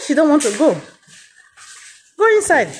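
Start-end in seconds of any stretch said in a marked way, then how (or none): 2.2-2.7 calling out. Real, not acted. Ordering the animal inside.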